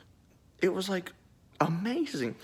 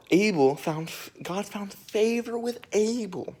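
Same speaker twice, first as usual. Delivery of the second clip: ordinary talk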